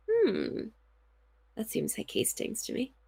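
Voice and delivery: soft pondering murmur as Hastings; a thoughtful, hushed Hastings. Pretend. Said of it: "That's me being Hastings".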